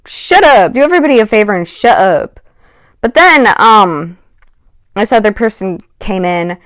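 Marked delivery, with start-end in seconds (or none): 0.0-2.4 annoyed. Real, not acted. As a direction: snap at her, fed up. Speaker venting at the girl.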